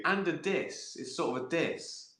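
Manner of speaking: normal talking voice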